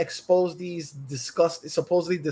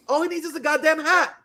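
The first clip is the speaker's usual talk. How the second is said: usual speaking voice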